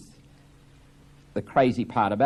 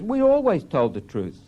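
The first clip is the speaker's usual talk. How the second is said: indignant protest; 8 semitones higher, 5 dB louder than usual. Acted. Happy protests.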